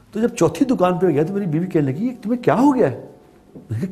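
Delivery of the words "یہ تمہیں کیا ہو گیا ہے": higher-pitched retold questioning of a husband; puzzled wife. Wife asks husband what has happened to him, puzzled. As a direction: imitate a wife's baffled question, pitched up.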